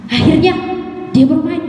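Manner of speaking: ordinary talk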